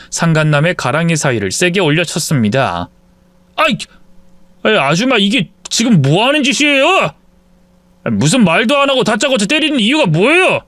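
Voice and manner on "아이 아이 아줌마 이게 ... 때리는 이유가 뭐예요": high, pained yelp of protest; man yelping after being struck in the groin. Acted. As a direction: cry out as the umbrella lands. Lover hit with umbrella.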